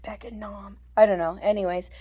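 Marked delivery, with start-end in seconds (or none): 0.0-0.8 soft, voiceless rasp, much softer; raspy whisper. Shown as a demonstration. Vlogger showing how the old man talks, raspy whisper. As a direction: whisper it like a ruined voice. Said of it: "really raspy".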